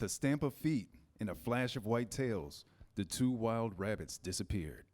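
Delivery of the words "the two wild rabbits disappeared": usual speaking voice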